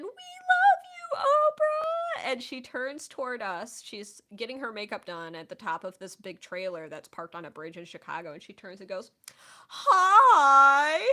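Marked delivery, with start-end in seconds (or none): 0.0-2.3 high, loud, drawn-out fan chant; long shrieked cry of adoration; crowd screaming. Retold or imitated. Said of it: "we are all screaming". 9.7-11.0 big, loud, drawn-out greeting; booming talk-show star greeting; Oprah. Retold or imitated. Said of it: "it was the most Oprah hi".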